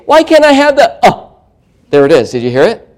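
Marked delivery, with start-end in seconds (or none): 0.1-1.3 put-on high teen plea cut short by a grunt; choked-off whining. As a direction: whine high until a throat noise stops the words. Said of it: "it stops them from speaking".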